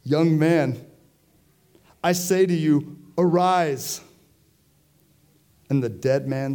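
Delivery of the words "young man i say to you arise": higher, louder call to rise; Jesus raising the young man with a loud command. Retold or imitated. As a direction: command the dead man to get up. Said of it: "And he said".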